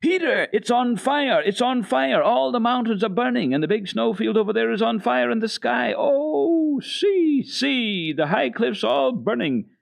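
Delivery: high, loud, breathless wonder; girl crying out to Peter that the mountains burn; excited exclaiming. Acted. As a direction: shout in amazement at the glowing peaks. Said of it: "Heidi jumped up and exclaimed".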